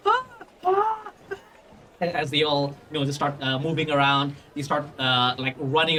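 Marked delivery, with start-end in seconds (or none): 0.1-1.0 high mock screams of alarm; short panicked cries, acted; crowd's scream. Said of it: "As suddenly people just go".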